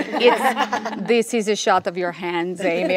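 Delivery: put-on light French Canadian accent with laughter; deadpan director voice in a French Canadian accent; laughing French Canadian accent. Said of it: "He's like".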